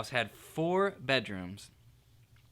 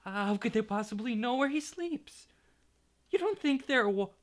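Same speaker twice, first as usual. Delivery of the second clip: quivering voice; 9 semitones higher than usual. Acted. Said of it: "in a quivering voice".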